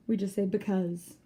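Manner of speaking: ordinary talk, nothing marked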